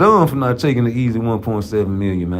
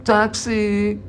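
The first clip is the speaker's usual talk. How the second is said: sing-song toxic; 11 semitones higher than usual. Shown as a demonstration. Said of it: "Y'all toxic".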